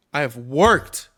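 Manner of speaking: loud, hammed-up self-pity; mock father. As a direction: ham up his noble claim. Mocking the father's words.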